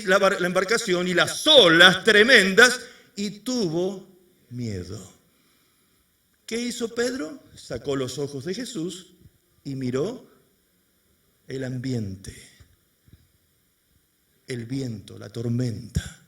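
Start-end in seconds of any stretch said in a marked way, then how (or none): 4.5-5.1 much lower, softer; hushed fear. A narrator's reading. Fear drops the voice. 7.6-16.2 low, soft, slowing; ominous hush. A narrator's reading. Ominous narration of doubt.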